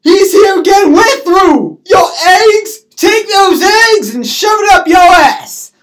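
loud, high, wailing rage, acted; screamed breakup fight. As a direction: scream it out to the last word. Retold shouting match.

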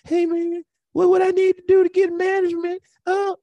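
high pleading mock voice; whiny young comic. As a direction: beg like a needy newbie. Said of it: "comics come in with their hands out".

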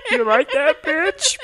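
high, nasal cartoon alien voice; squeaky little Martian, leering; Marvin the Martian. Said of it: "my Marvin the Martian impression".

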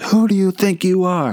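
high, excited bowler yelling at the crowd; imitating a thrilled bowler at the title win; Pete Weber. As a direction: ham up a pumped-up champion's boast. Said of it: "He's like".

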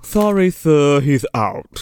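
mock formal butler with a lisp. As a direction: turn a caller away, lisping. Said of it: "this cocaine fiend of a butler".

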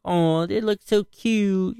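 high, slow cooing imitation of a kid; lightly done kid voice; daughter's cute voice. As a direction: coo it like a child seeing cows. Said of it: "you're like".

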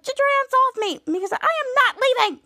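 high, indignant refusal; acted defiant protest. Miles being grabbed by Chopper, high defiant protest.

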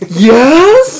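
sharp gasp then a very high, loud yes; gasp, then squeal yes in disbelief; starstruck gasp yes. Retold or imitated. Friend imitates starstruck fan answering, gasp and high squeal. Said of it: "your eyes go all wide".

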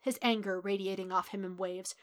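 ordinary delivery

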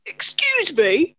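high, slow mock southern English plea; drawn-out southern English accent. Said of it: "what's the accent".

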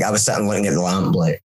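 ordinary talk, nothing marked

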